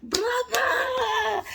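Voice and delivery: long high-pitched movie-style holler; excited greeting shout. Acted. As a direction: shriek it out loud and high, drawn out. Re-enacts a movie greeting scream.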